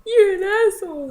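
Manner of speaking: high, loud outburst; retold angry yell. Daughter hurt by dad's answer, angry yell. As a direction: yell it at him, hammed up. Retold phone quarrel with dad.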